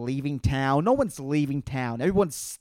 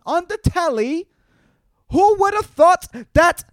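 put-on British accent, much higher and louder; vague British accent; 14 semitones higher, 6 dB louder than usual. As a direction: ham up a mystery British accent, pitched way up. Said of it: "I don't even know what accent that is".

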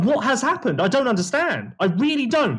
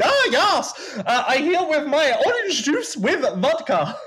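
mock German accent, pitched higher; 9 semitones higher, slower than usual. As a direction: ham up a German rider's accent. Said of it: "I don't know why he's German".

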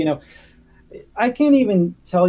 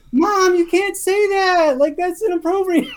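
put-on shocked kid; 13 semitones higher, 3 dB louder than usual. Kid in back seat scolding mom, high mock outrage. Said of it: "And I was like".

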